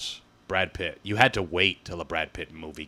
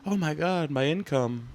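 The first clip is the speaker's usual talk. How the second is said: high, whiny mock wail over lost income; hammed-up self-pity; mock whiny TikToker; 8 semitones higher than usual. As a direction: play a melodramatic influencer mourning money. Mocking whining TikTokers.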